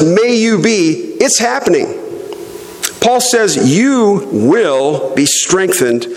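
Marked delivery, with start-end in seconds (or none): 0.0-1.2 "may you be" said slow and high, then brushed aside; quoted phrase set up for contrast. Shown as a demonstration. Pastor correcting the verse's wording for his congregation, slow and high. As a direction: hold up "may you be" as the weaker reading. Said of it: "it's not just".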